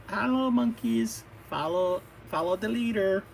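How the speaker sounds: high, drawn-out soothing character voice; calming sister. Acted. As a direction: coax them into calm in a high voice. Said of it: "make sure that you're calm and safe".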